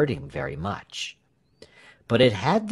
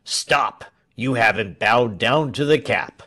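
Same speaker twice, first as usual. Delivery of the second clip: soldier's raised-voice challenge; mildly shouted command; shouted order; 3 dB louder than usual. A narrator's reading. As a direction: call out a stern order to halt. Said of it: "shouted the soldiers".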